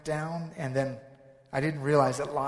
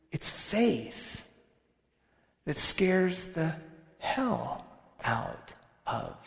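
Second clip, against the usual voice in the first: ordinary talk, nothing marked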